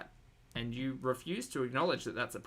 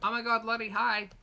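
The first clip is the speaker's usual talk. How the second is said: excited greeting; 11 semitones higher, 5 dB louder than usual. Real, not acted. Greeting a chat viewer.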